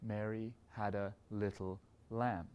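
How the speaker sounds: slow build through the line into "lamb"; slow, stress on LAMB. Shown as a demonstration. Conductor sings a little crescendo into the lamb. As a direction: grow slowly toward "lamb" and land it. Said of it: "If we go to the lamb".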